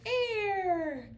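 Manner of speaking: teacher imitating her pupils' shouted answer; mock class shout. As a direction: echo what the kids yell, a little high. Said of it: "I can hear you shouting".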